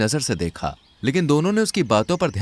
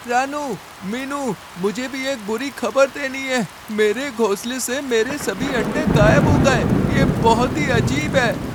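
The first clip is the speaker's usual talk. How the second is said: pigeon's voice; 10 semitones higher than usual. A narrator's reading. Pigeon tells the sparrows his eggs are gone, high bird voice. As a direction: play the worried pigeon bringing bad news. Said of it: "कबूतर भी वहाँ पे आ पहुँचा और रानू और मीनू से बोला".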